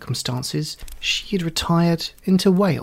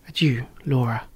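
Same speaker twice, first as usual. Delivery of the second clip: whispered farewell; 3 semitones lower than usual. A narrator's reading. Laura whispering farewell to Marianne at letter's end. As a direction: whisper the sign-off Adieu, Laura.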